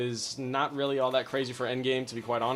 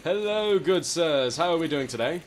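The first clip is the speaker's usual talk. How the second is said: hammed-up polite gentleman, louder; mock gentleman; 6 semitones higher, 6 dB louder than usual. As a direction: greet them like a courtly English gent. Greets players in gentleman voice.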